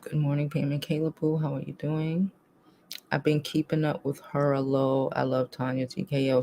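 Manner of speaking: quiet, low chat greeting; soft greeting. Real, not acted. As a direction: read names gently, under the breath. Reading chat greetings softly.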